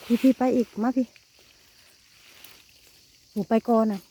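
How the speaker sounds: calling out. Real, not acted. Calling daughter onward through the woods. (ร้องเรียกลูกให้ตามมา)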